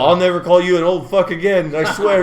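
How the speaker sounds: imitating a friend swearing, loud and high; punchline delivered as a big mock oath; emphatic vow. Friend to older passenger after escaping trouble, emphatic mock vow.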